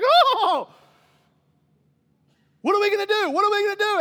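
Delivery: loud, high-pitched mock panic; anxious panic. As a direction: fret frantically, hammed-up. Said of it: "worry and fret and anxiety".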